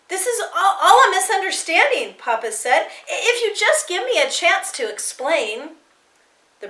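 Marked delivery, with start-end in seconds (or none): 0.1-2.2 high, hurried, stammering protest; panicked pleading. A narrator's reading. Father trying to explain himself to the Nazis, panicked pleading. 3.1-5.8 higher, stammering appeal; panicked pleading. A narrator's reading. Father begging the brown shirt to listen, panicked pleading.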